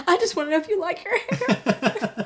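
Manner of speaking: high, slow put-on voice; slow falsetto-like character quote; hairstylist impression.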